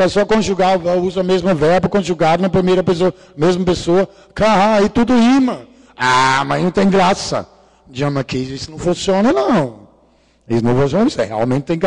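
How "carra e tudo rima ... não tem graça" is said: ordinary talk, nothing marked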